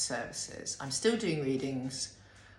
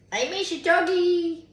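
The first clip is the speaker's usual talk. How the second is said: much higher, louder doggy talk; 13 semitones higher, 9 dB louder than usual. Real, not acted. Telling the dog she misses having a doggy, in baby talk.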